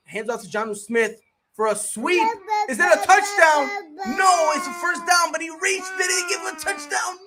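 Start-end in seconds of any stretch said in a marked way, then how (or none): 2.7-7.2 high, raised play call; excited call. Real, not acted. Streamer calling a close touchdown, high and excited.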